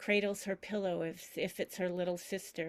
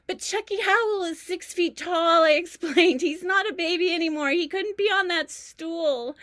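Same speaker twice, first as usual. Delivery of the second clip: much higher and louder, laughing through the words; laughing hard; 11 semitones higher, 9 dB louder than usual. Retold or imitated. Granddaughter explaining to family, laughing much higher and louder.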